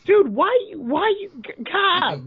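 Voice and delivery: high-pitched disbelieving dude why; shocked outcry. Real, not acted. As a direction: squeal in outraged disbelief. Outraged reaction to joke.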